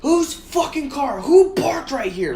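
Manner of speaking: high, slow furious demand; mock scream. Angry driver demands the car's owner, high retold yelling. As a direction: stretch each word like yelling across a lot. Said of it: "Like, I'm screaming it".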